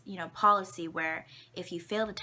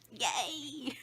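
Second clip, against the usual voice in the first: higher, bright mock celebration; mock cheer; 6 semitones higher than usual. Real, not acted. Ending the sad story to the camera with a higher mock cheer. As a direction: cheer it out ironically.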